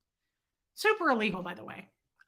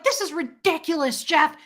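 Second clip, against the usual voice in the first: loud, higher angry rant at Jeff; yelling; 6 semitones higher, 6 dB louder than usual. Retold or imitated. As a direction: complain loudly to Jeff. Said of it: "And I'm yelling".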